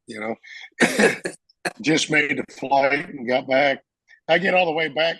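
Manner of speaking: ordinary talk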